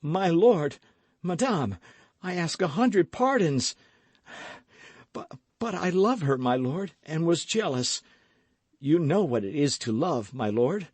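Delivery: pleading lover. A narrator's reading. D'Artagnan pleading with the duke.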